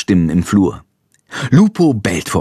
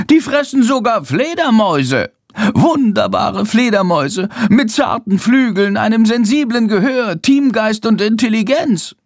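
very high, impassioned bat; high-pitched protest, slowing for emphasis at the end; raised bat voice; 11 semitones higher than usual. A narrator's reading. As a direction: exclaim indignantly in a high bat voice. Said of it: "fügt mit erhobener Stimme hinzu".

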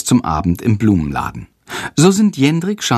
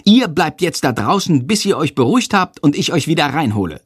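high, lightly acted scolding; hurried, pitched-up telling-off; angry teacher; 7 semitones higher than usual. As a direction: send unruly pupils out, indignantly. Teacher sends both pupils out.

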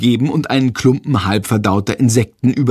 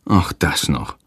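low, weary groan; 9 semitones lower, 3 dB quieter than usual. A narrator's reading. Hans learns of the accident, groaning. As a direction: groan it low, as one more nuisance. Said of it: "stöhnt Hans".

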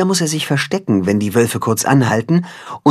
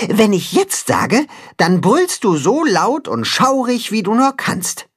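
cat-chicken hero; 8 semitones higher than usual. Acted. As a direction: plot the roar carefully. Hero instructs goat.

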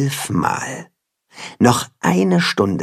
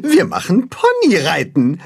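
higher-pitched happy character voices; delighted; 8 semitones higher than usual. A narrator's reading. Margot and Mirabell look forward to pony rides, delighted. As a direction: cheer it, pleased with the plan. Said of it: "freuen sich die jetzt blitzblanken Margot".

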